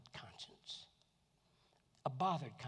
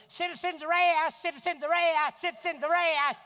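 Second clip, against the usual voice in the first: loud, high excited hollering; Gomer's yell; 11 semitones higher, 15 dB louder than usual. Retold or imitated. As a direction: holler it like an eager deputy. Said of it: "Gomer runs out and goes".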